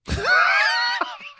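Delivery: a high shrieking laugh bursting out; burst of laughter. Real, not acted. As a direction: let a shrieking laugh escape. Reacts to the divorce question.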